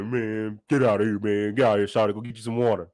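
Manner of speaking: low mock-annoyed brush-off; a hint of a jealous man shooing; lightly voiced mad Murder. Little Murder shooing Big T, low grumpy brush-off. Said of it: "mad, like".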